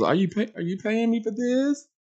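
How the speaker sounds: host imagining asking Kenya about pay, sceptical; incredulous question. Retold or imitated. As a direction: rise in disbelief at the end. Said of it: "my first question was".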